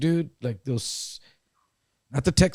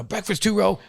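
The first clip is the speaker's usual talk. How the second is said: higher announcing guard call; 8 semitones higher than usual. Retold or imitated. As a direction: call out the row number like a guard.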